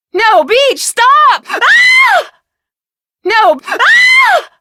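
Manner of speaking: desperate high pleas breaking into loud screams; panicked screaming. Acted. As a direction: scream for him to stop as you're dragged off. Acted struggle, pleading then screaming.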